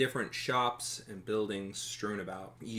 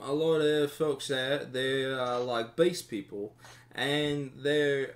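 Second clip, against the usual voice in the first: chatty town guard; 3 semitones higher than usual. Acted. Guard tells travelers about the inn, chatty. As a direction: chat away like a helpful local.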